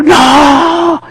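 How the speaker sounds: high, loud cry of "No"; shout. Retold or imitated. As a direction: call out after him. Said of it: "Y le grito".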